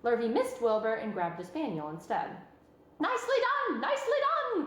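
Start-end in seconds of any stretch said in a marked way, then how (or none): 3.0-4.7 high, loud, excited goose voice with laughter; crying goose. A narrator's reading. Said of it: "cried the goose".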